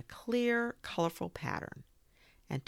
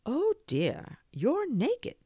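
high, loud shocked gasp; 11 semitones higher, 5 dB louder, slower than usual. A narrator's reading. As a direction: react in startled surprise. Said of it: "The moon looked down and gasped".

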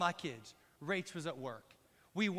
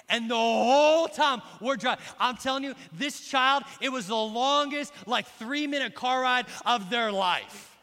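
loud, raised voice driving the point home; emphatic loud telling; 7 semitones higher, 11 dB louder than usual. Real, not acted. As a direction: hammer the story for the crowd. Recounting the tense ride.

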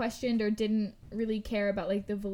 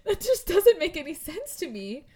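laughing; 8 semitones higher, 9 dB louder than usual. Real, not acted. Laughing disbelief, voice cracking.

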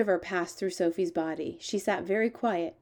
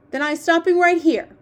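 loud, high, offended giant; sharply indignant character voice; 9 semitones higher, 10 dB louder than usual. Acted. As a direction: snap it out, taking offence. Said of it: "the B F G said sharply".

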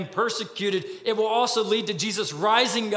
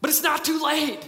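higher, urgent plea to the crowd; pleading Stephen; 7 semitones higher than usual. Acted. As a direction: beg them to see it before it's too late. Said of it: "pleading with them".

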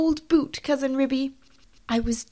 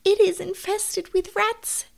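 high-pitched tearful complaint; 13 semitones higher than usual. Acted. Tabitha telling her cousin about rats, tearful and high. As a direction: wail it like a tearful mother cat. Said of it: "said Tabitha tearfully".

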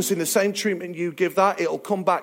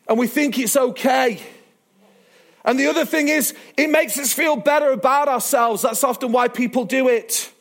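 raised sermon voice, building in pitch; emphatic preaching; 5 semitones higher than usual. Acted. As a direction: press the warning on the congregation. Preaches to congregation.

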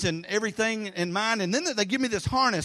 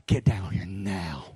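low, slow, clipped-back order through frustration; a dad at the end of his rope, holding it in; tense order; slower than usual. Retold or imitated. Pastor calling son down from zip line, tense low order.

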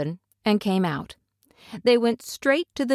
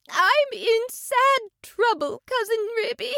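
tearful. A narrator's reading. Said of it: "shedding tears".

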